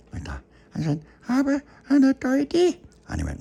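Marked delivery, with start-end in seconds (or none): 1.3-2.7 very high, loud little voice; high-pitched character cry; high squeal. Acted.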